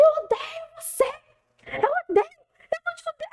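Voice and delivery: very high, strained mock rage; furious child outburst. Boy with ODD cursing at his teacher, high acted rage.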